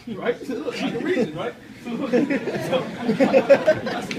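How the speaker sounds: real laughter breaking through the words; laughing. Real, not acted. Telling the audience about the brother, laughing. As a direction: laugh out loud while you tell it.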